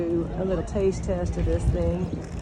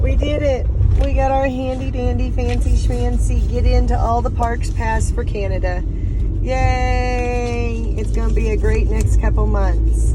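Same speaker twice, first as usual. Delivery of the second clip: excited; 5 semitones higher, 8 dB louder than usual. Real, not acted. Cheering after crossing into Canada.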